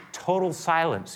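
ordinary delivery

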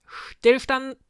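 loud, high drill-sergeant shout; booming command. A narrator's reading. Sengespeck halts his witches' squadron, booming the order. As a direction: boom it out across the ranks. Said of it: "dröhnte Herr Sengespeck".

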